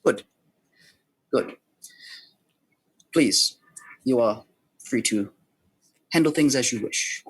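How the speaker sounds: deliberate schemer with long pauses; lightly voiced plotter, measured and unhurried; measured, ominous schemer. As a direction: issue a grave warning, phrase by phrase. Game master voices a scheming contact.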